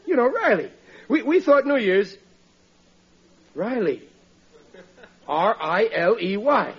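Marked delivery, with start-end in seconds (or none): 5.3-6.7 each letter spelled out slowly; slow spelled letters down the phone line; spelling it out. Acted. Spelling name over the phone.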